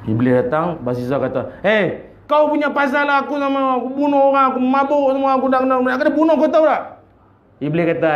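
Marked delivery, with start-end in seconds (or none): 1.7-7.0 higher, indignant blaming; accusing complaint. Acted. Condemned sinner angrily blaming the devil. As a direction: throw the blame at the devil.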